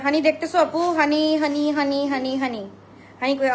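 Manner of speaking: loud call to her daughter. Real, not acted. Calling to her little girl Honey during the live, loudly. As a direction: call her name across the room.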